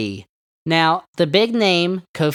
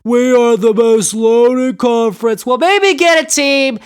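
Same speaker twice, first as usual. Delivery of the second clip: mocking boaster. Sports host mocks the Big Ten's overrated boasting, mocking.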